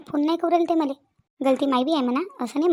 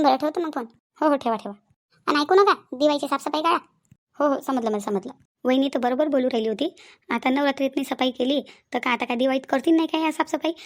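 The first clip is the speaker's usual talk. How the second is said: ordinary talk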